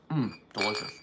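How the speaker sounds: talking through a mouthful of candy; chewing while speaking; mouth full. Real, not acted. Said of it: "I'm talking with my mouth full".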